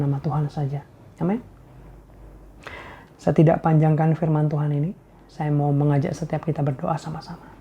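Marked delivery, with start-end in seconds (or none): none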